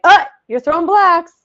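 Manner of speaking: a parent marching over to lecture; mock overbearing parent. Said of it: "just be like".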